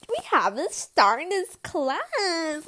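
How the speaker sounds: slow, loud mock teacher voice; drawn-out teacher announcement; teacher announcing. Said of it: "and just goes".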